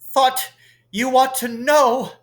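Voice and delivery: high, stammering, breathless panic; gasping terror. A narrator's reading. Quirrell warning Dumbledore of the troll, stammering in fear. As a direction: gasp out the news in terror. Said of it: "and gasped".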